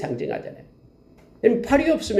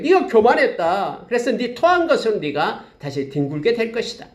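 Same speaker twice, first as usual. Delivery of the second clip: a touch of stern divine rebuke, slightly higher; voice of God; 8 semitones higher than usual. Acted. God rebuking proud Moab, mildly stern. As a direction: speak down to Moab as an accusing God. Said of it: "하나님이 말씀하는 거예요".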